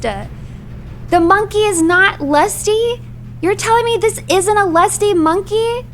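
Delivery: high, incredulous outrage; pissed-off disbelief. Real, not acted. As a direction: rant in high-pitched disbelief at the ruling. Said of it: "now I'm pissed".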